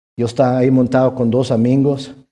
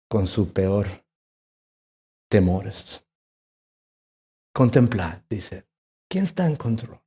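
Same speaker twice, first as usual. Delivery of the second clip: much softer, low, gentle voice; tender reflection; 3 semitones lower, 6 dB quieter than usual. Acted. Pastor reflecting to the congregation, softly tender. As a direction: speak it like a quiet prayer. Soft intimate sermon close.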